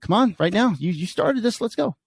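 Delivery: high, urgent retold challenge; high-pitched, mildly combative goading. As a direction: replay calling someone out to fight. Retelling his challenge to rival.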